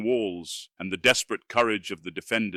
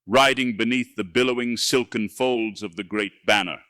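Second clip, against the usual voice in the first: ordinary delivery, nothing marked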